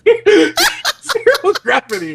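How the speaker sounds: giggling through it, stretching the words out; laughing. Real, not acted. Reading the silly sex scene aloud, slowly laughing. Reader giggling at own story.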